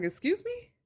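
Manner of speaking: high, shocked "excuse me"; outraged surprise; incredulous. Retold or imitated. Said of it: "I'm like".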